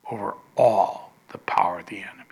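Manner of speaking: urgent hushed whisper; a preacher whispers the promise to his congregation. Real, not acted. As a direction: breathe it out fast and secret.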